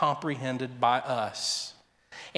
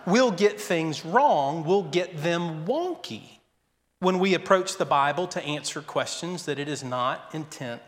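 ordinary talk

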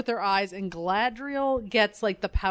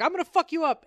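mock threat; 8 semitones higher, faster than usual. Bear guy threat.

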